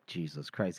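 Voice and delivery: low, hushed exasperated aside; acted quiet disbelief; muttered oath. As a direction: mutter it under the breath. Muttered aside to God remark.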